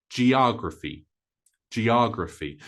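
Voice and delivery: geography the American way, jee-OG-ruh-fee; four syllables in geography, stress on the O; American pronunciation. Shown as a demonstration. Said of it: "American English sometimes pronounces it".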